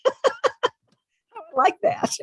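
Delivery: high, slow, laughing through the words. Real, not acted. Host laughing at her own hope of a prize. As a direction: giggle out the wish.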